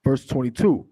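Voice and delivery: slow, measured scripture reading. Acted. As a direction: read the verse out slowly, weighting each phrase. Reads scripture aloud to congregation.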